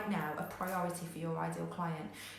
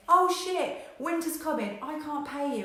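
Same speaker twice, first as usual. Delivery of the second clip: loud, high mock panic; hammed-up provider freaking out; mock terror, higher and louder; panicking; 6 semitones higher, 6 dB louder than usual. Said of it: "one of them starts panicking".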